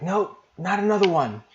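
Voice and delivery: a mother at her wits' end; exasperated mom. Acted. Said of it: "she's like".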